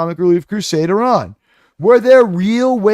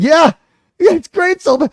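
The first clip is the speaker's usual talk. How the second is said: mocking the host; 7 semitones higher than usual. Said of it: "It's like".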